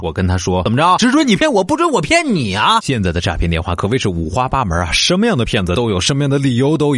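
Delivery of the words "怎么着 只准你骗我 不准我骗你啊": mocking taunt. Taunting the scammer back.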